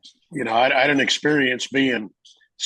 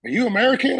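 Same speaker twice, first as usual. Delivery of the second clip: friendly stranger; 9 semitones higher, faster than usual. Retold or imitated. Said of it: "he goes".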